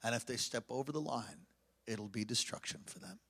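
low, hushed, solemn voice; soft and low, as if relaying God's words; God speaking. Retold or imitated. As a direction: deliver a quiet, grave warning. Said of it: "God speaks to me as clear".

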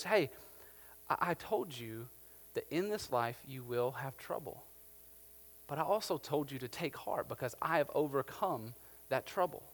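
soft, unhurried voice of God; quiet, patient reminder with comfort in it; gentle reassurance. Acted.

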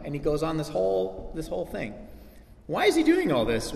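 ordinary talk

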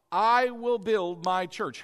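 "I" held long and hit firmly; emphasized. Retold or imitated. Preacher repeating Jesus' words to drive them home, heavy stress on "I". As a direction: punch and stretch "I", taking the phrase slowly. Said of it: "And I have emphasized this".

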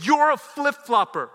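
loud, high angry outburst; acted furious name-calling; angry accusation. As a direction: snap loudly at the child, retelling it. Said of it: "I was angry at everything".

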